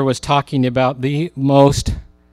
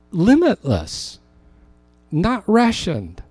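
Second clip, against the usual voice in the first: slow, higher, every word weighted; slow emphasis; 6 semitones higher, slower than usual. Acted. Preacher stressing to the congregation that God's power is limitless, slow and weighted. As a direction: lean on Limitless, not rationed, slowly.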